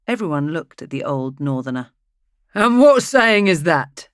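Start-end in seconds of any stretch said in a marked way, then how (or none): 2.5-4.1 jolly. A narrator's reading. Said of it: "jollying the party along".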